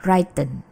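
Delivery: WRITTEN built on write, RYE-ten; long i in WRITTEN; misread as write. Shown as a demonstration. Teacher shows a reading from verb one, mispronounced. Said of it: "diucapkannya berdasarkan verb one-nya".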